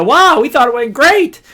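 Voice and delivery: high, loud upbeat mimicry of a proud team; pleased boast. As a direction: imitate someone thrilled with how it went. Retold pleased group after presentation.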